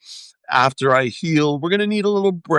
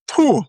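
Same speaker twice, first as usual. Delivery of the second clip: loud exhale; 10 semitones higher, 4 dB louder than usual. Real, not acted. Exhaling after fiery words.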